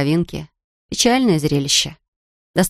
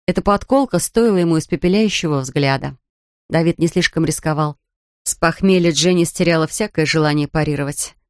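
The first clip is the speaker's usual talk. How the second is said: ordinary delivery, nothing marked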